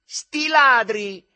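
high, long, very loud yell; shriek. A narrator's reading. Shouting back from far enough away, accusing shriek. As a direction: yell it accusingly across a distance. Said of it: "si voltò di sguincio e strillò".

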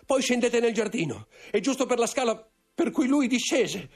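sfogo acuto e concitato; confessione angosciata. A narrator's reading. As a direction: incalza con terrore, la voce molto alta. Intendente sconvolto confessa l'assassinio. (sfogo angosciato, voce acuta)

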